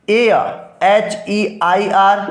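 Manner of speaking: "air" then each letter spelled out slowly; slow spelling. Shown as a demonstration. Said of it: "फिर हमने क्या कहा भाई".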